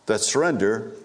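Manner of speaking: usual speaking voice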